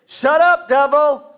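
shouted high and loud, Shut up, devil; loud rebuke. Shown as a demonstration. Preacher shows the crowd how to rebuke the devil, loud.

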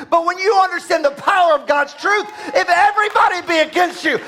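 high, driving pulpit shout; full-force preaching. Acted. Preacher urging congregation to trust God's truth, full-force preaching.

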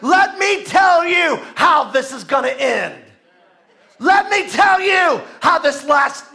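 loud, slow challenge to Goliath; defiant declaration. Acted. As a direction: hurl each word slowly at the enemy. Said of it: "he began to tell Goliath".

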